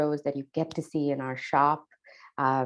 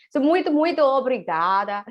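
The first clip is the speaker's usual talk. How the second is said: Portuguese thanks; 9 semitones higher, 6 dB louder than usual. Shown as a demonstration. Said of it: "Those are the two languages I can say them in".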